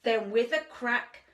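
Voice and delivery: lilting rhymed storybook read; sing-song storyteller voice; reading to children. A narrator's reading. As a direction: read it like a bedtime picture book.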